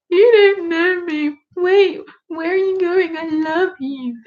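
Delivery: high, wailing drunk impression; mock drunk shouting. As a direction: wail it like a maudlin drunk, hammed-up. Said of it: "shout at each other".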